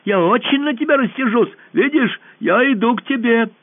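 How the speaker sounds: high, loud warning; girl warning the monster about the trees; threatening shout. A narrator's reading. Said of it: "крикнула Алиса".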